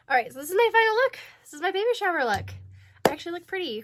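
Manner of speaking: high, loud and fast; bursting with excitement to show off; excited. Real, not acted. Showing off final outfit.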